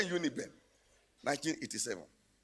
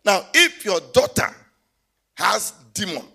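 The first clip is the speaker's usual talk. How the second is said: loud, sung-out pulpit cry; loud, half-sung sermon call; full-force preaching; 4 semitones higher, 11 dB louder than usual. Acted. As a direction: preach it, half singing the line. Preaching to congregation.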